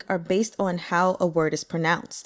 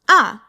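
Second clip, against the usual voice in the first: short loud "Ah" falling sharply from high; striking fourth tone; 3 semitones higher, 9 dB louder than usual. Shown as a demonstration. Teacher demonstrating Mandarin fourth tone, sharp falling "Ah". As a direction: strike "Ah" down hard from a high start. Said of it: "Fourth tone".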